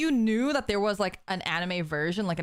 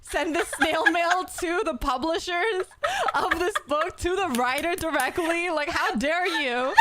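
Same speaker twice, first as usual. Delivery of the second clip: indignant friend; 9 semitones higher than usual. Retold or imitated. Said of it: "Mita's like".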